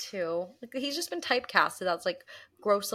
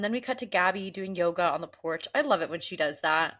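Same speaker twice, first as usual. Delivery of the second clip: ordinary delivery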